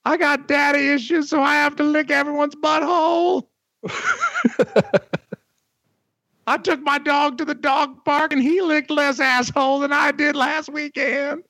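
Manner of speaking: very high falsetto mimicking his voice; high cartoon voice; copying the falsetto. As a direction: steal his squeaky voice. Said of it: "I kinda ran with his bit".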